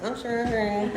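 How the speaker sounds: "surrendering" high and giggly; laughing, amused. Retold or imitated. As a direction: deliver "I'm surrendering" high through a laugh. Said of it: "I'm like".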